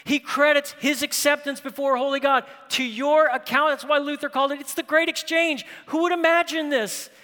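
loud, high, ringing sermon delivery; soaring pulpit proclamation; full-force preaching. Acted. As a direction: preach it out to the whole room. Preaching the great exchange.

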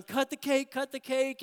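high, loud chant of the crowd; a happy mock shout of cut the cake; retold party shout. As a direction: call it out like a cheering crowd. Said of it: "they start shouting".

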